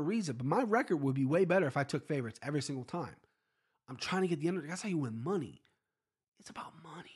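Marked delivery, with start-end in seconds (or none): 6.4-7.1 quick conspiratorial whisper; whispered aside. Real, not acted. Podcaster lets listeners in on why he bets, whispered. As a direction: lean in and whisper it like a secret. Aside about betting for money.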